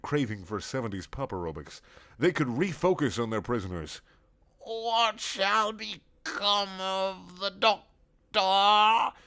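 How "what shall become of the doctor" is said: very slow, high slurred robot. Acted. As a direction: drag and slur every word. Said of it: "in a slurred voice".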